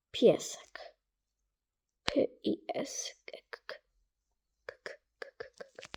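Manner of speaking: whispered coaxing to a dog. Real, not acted. Gamer whispering to coax a stray dog. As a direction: breathe the word to a dog.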